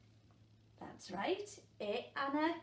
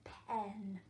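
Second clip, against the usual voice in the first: whisper; 2 semitones lower than usual. Shown as a demonstration. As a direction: whisper the word. Sounding out the word softly.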